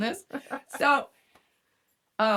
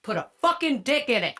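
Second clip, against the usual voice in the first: loud, higher punchline after a hush; sudden crude outburst; 6 semitones higher, 6 dB louder than usual. Retold or imitated. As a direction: drop the seduction and blurt it loudly.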